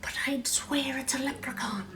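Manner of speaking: soft unvoiced whisper; hushed whisper to himself. A narrator's reading. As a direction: breathe it, awed. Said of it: "Jamie whispered".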